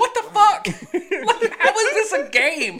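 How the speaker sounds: can't believe it, cracking up; laughing disbelief. Real, not acted.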